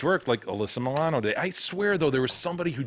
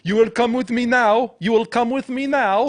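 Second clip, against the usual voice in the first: high, loud fake foreign accent; bad Borat accent; 10 semitones higher, 5 dB louder than usual. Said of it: "She was doing a bad Borat".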